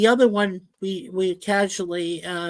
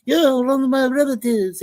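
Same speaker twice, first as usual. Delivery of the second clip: imitating the old owner's Greek accent, a bit higher; 6 semitones higher than usual. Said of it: "he kinds of says this in a Greek accent".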